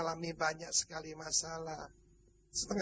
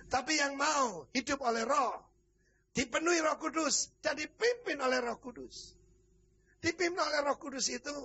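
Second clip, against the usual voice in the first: raised, emphatic preacher's voice; impassioned preaching; 4 semitones higher than usual. Real, not acted. Preacher urging believers to be led by the Spirit, impassioned preaching. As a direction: press each phrase on the congregation.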